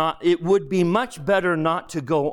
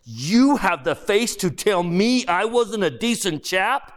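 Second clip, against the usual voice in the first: higher-pitched, incredulous indignation; affronted; 7 semitones higher than usual. Acted. Ghost told he wasn't decent, affronted. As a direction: throw the insult back as a question.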